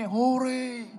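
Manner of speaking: high, drawn-out mock "hooray"; mock cheer. Preacher imagining David fleeing happily, mock cheer. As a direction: pretend celebration, high-pitched. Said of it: "keluar dengan dia bilang begini".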